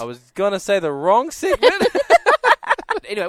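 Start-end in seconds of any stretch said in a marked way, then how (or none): 1.8-3.0 loud laugh up high; real loud laugh. Real, not acted. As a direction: crack up laughing. Laughing at own mistake.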